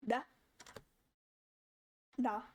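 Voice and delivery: "Da" with a light tongue tap; D sound demo. Shown as a demonstration. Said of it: "you make an L sound like this".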